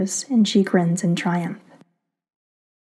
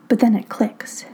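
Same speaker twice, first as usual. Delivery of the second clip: plain reading voice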